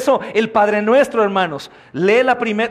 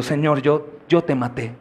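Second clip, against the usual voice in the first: humbled contrite prayer; 5 semitones lower than usual. Acted. Saulo humbled, imagined prayer.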